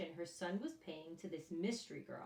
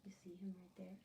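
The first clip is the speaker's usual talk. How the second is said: whisper; 11 dB quieter, much faster than usual. Real, not acted. Teacher whispering to the children about the picture. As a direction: breathe it, pointing at the picture.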